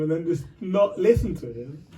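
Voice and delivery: ordinary talk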